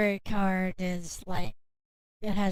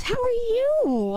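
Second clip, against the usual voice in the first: high, slow and loud question; sing-song; 14 semitones higher, 4 dB louder, slower than usual. Acted. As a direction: lift it up high and draw it out.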